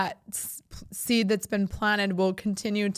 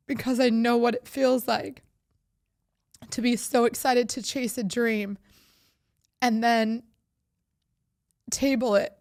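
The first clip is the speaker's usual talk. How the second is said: real choked-up voice, pitched higher; getting emotional; 4 semitones higher than usual. Real, not acted. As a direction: push on through rising emotion, pausing to hold it together. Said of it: "I'm getting so emotional".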